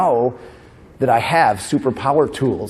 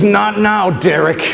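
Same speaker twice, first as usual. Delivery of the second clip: higher, louder mock snap at Derek; 7 semitones higher, 4 dB louder, much slower than usual. Frazzled mom snapping at her teasing son, loud. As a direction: bark it back like a frazzled mom. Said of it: "She goes".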